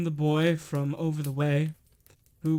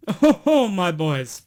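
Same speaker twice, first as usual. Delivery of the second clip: jolly shout; 10 dB louder, slower than usual. A narrator's reading. Fezziwig calls out jovially to his apprentices. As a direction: bellow with glee at the boys.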